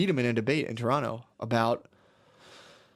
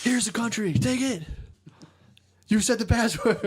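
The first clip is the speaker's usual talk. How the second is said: high, excited mock crowd voice, much higher; eager crowd; 10 semitones higher than usual. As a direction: gleefully hand over the keys, pitched way up. Crowd handing power to populist.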